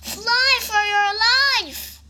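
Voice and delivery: high, drawn-out alarm call; theatrical storybook panic; urgent warning. A narrator's reading. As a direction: cry the warning slowly and high. Animals urge Baby Bug away.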